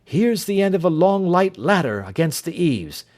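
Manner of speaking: a clipped, commanding Holmes taking charge; urgent detective giving orders; Holmes, brisk and urgent. Acted. Holmes warns of danger.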